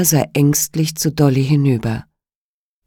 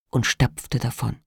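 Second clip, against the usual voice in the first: ordinary delivery, nothing marked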